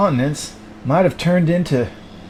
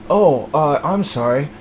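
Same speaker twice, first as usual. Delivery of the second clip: ordinary talk, nothing marked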